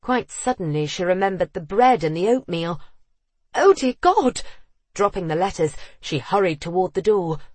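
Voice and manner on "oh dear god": high, loud cry of sudden alarm; alarmed exclamation. A narrator's reading. Julia suddenly remembering the burning bread, loud and alarmed. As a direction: burst out high and loud in panic.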